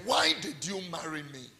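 ordinary talk, nothing marked

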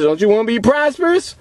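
usual speaking voice

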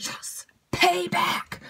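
slow, breathy gloating payback. Retold or imitated. Gloating slowly over the sick villain's payback. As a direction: savor it like sweet revenge.

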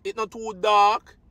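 ordinary talk

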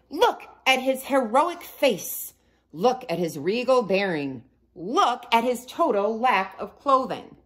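pushy and proud; demanding. A narrator's reading. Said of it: "they demanded".

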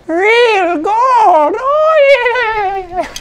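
put-on a leprechaun thrilled by gold, in an Irish accent; an excited Irish-accent shout. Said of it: "a terrible Irish accent".

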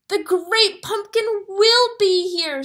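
put-on girl yelling back at teasing kids. A narrator's reading. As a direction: defend your friend against the teasing. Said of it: "Sally yells, defending Linus".

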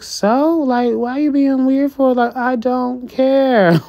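dismissive. Retold or imitated. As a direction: brush them off like it means nothing. Voicing Chrisean's dismissive reply.

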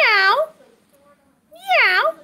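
high playful meow; high mock cat meow. Shown as a demonstration. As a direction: meow like a kitten for the kids. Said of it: "What's a cat say?".

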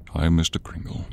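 normal talking voice

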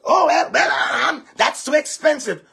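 mock her high complaint; a high put-on woman's voice; mimicking the fiancée. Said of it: "and I said".

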